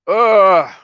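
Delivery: a loud, high anguished sob. Real, not acted. Trucker crying on camera lets out a loud, high sob. Crying over missing dad.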